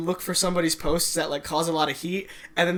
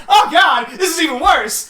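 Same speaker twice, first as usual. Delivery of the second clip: mock panicked shout; 10 semitones higher, 9 dB louder than usual. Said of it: "and you're like".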